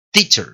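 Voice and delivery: "teacher" with the final E reduced to a faint schwa; correct English pronunciation. Shown as a demonstration. Teacher leading a repeat-after-me drill, correct weak ending. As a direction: model "teacher" clearly, weak last vowel. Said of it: "Decí conmigo".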